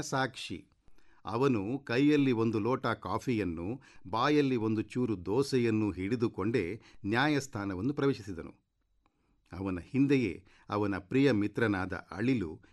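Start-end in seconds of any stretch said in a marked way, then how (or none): none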